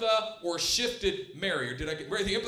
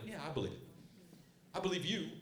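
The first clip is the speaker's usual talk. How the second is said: softer, doubtful agreement; half-hearted; 3 semitones lower, 12 dB quieter, much faster than usual. Retold or imitated. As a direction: say it but don't mean it. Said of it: "Kinda half-heartedly".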